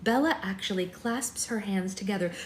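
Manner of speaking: plain reading voice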